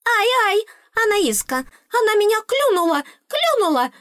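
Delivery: very high-pitched squealing complaint; sky-high squeal. A narrator's reading. Pecked fairy complaining to the heroine, sky-high squeal. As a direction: squeal it like a tiny wronged fairy. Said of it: "визг взвился до немыслимых высот".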